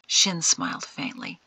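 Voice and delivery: usual speaking voice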